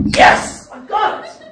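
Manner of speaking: explosive, high-pitched shout of triumph; jubilant yell. Retold or imitated. Preacher re-enacting his good-news phone call, jubilant yell. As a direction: yell it like you just won, full blast. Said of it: "So I put the phone down and went".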